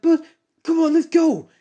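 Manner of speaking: very high, loud hurrying; urgent shout. Acted. Urging friend to flee, very high loud shout. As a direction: shout to get moving now.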